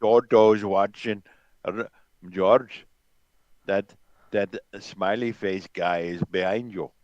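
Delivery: a light take on a stern team principal; Toto Wolff impression. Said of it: "That's my Total Wolf impression for you".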